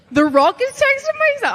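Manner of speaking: high, loud and laughing in disbelief; stunned disbelief. Retold or imitated. Wrestler recalling the Rock's text, shrieking in laughing disbelief. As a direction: shriek it out like you can't believe your eyes. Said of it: "And I was like".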